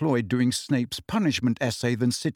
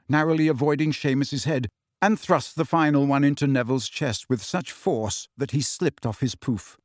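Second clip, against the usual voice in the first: ordinary delivery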